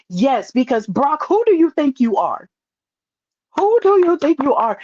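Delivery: excited cheering. Retold or imitated. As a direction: cheer at Brock, pitch climbing high. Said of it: "I was just like".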